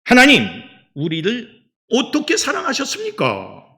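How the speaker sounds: high, loud acted challenge to God; indignant protest. Israelites questioning God's love, indignant protest. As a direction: cry out the question as a complaint. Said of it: "하는 항변을 하고 있는 거예요".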